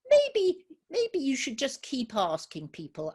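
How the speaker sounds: high bright start, then friendly advice; a kind little mouse giving tips; friendly field mouse. Acted. Field mouse advising little rabbit, a light friendly voice.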